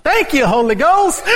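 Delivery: high, loud, laughing call-out; imitating a yell. Imitating Reverend Borders yelling to the Holy Ghost. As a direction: cry it out like the old pastor. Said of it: "he used to yell out".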